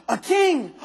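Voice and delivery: excited gasp. Acted. Gasps at flipping a king.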